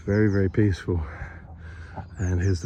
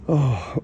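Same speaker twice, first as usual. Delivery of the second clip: breathless laugh with a cough; 5 semitones higher than usual. Real, not acted. Laughs at her own folly.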